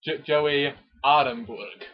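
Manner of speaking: usual speaking voice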